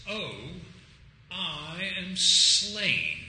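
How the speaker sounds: slow, drawn-out, loud mock death cry; comic dying line. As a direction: ham up a dying man's last words, very slowly. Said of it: "and out steps John Cleese and says".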